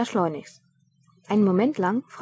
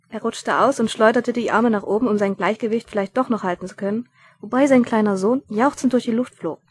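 ordinary delivery, nothing marked

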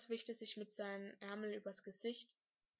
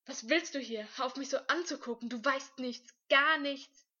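loud, fast outburst, higher pitched; furious shout; 5 semitones higher, 13 dB louder than usual. A narrator's reading. Speechless boy snaps at the one staring at him, shouting furiously. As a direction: yell it, peaking at the end. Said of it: "er schrie".

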